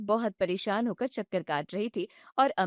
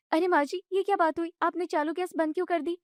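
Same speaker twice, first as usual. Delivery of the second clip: higher, indignant daughter-in-law voice; young wife protesting; 7 semitones higher, faster than usual. Acted. Bride objects when mother-in-law shuts off the gas, indignant and high. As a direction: push back, hurt and annoyed.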